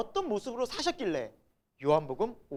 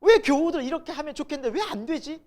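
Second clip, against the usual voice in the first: higher, louder mock grumbling; retold frustration; 7 semitones higher, 5 dB louder than usual. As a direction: re-enact exasperation at church members. Acting out irritation at congregation. (답답해서 따지는 말투를 재연하듯)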